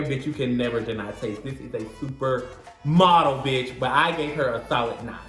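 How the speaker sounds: ordinary talk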